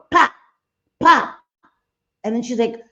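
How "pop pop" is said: two sharp, high-pitched "pop" noises, spaced apart; imitated cap-gun pops. Streamer retelling the cap-gun stunt, sharp imitated pops. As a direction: mimic a cap gun going off. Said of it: "And then you hear it going".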